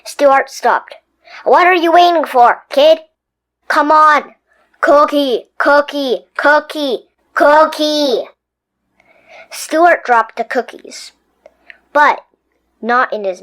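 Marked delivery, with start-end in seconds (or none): 1.4-8.3 louder chanting cookie; greedy tooth chant; urging tooth. Acted. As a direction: egg him on.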